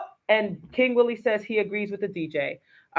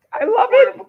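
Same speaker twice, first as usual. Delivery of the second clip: high, loud burst of delight; delighted cheer; 10 dB louder than usual. Real, not acted. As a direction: cheer the line on with real joy. Co-host cheers guest's line.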